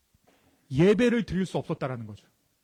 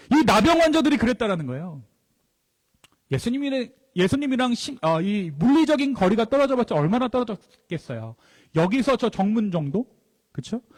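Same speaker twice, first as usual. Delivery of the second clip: usual speaking voice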